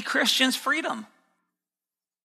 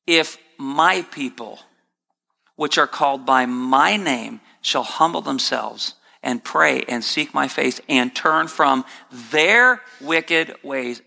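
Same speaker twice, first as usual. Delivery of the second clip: solemn scripture reading; 6 semitones lower than usual. Acted. Preacher reads scripture slowly.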